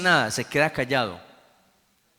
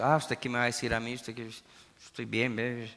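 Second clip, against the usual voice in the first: mock proud dismissal; 4 semitones lower, 7 dB quieter, faster than usual. Imita al soberbio que rechaza consejo.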